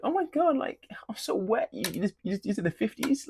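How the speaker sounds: put-on high, whispered girly gush; mock swooning girl. Vlogger mocking a girl impressed by his heavy lifting, in a high whispered gush. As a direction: pretend to be a dazzled girl at the gym.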